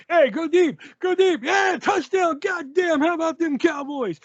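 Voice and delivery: high, loud football-style calling out; hyped-up deli guy. Retold or imitated. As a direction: holler it like you're throwing passes across the counter. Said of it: "just starts getting really into it".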